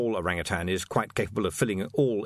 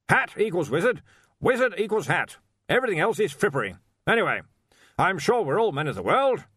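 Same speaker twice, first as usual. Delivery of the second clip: loud, high, blustering archchancellor; blustery old wizard; 8 semitones higher, 4 dB louder than usual. Acted. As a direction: demand it like a bluff old master.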